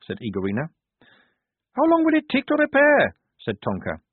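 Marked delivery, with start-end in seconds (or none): 1.8-3.1 very high, loud demand; Tonka's brash voice. A narrator's reading. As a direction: pipe up high and forceful. Tonka calls out her question.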